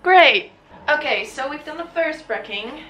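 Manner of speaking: loud, high cheer; excited. Real, not acted. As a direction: shout it out happily. Finishing the first task.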